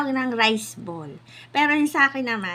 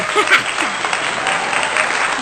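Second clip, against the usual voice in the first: breathy laugh; 6 semitones higher, 6 dB louder than usual. Real, not acted. Laughing after slip.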